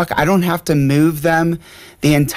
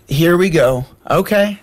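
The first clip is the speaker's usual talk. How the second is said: mock-weary parental sarcasm, higher on "okay"; dismissive, knowing sarcasm; sarcastic; 4 semitones higher than usual. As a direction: cut them off with a sarcastic okay. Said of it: "What does that sound like, sarcasm".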